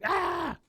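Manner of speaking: short, very high mock shriek; startled high "ah"; imitated scream. Luann startled by the air horn, imitated shriek. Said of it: "and then her".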